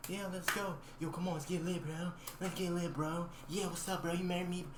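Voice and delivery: high hyped-up party bro; frat bro. Retold or imitated. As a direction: imitate pushy party bros. Classmates hyping him to dance.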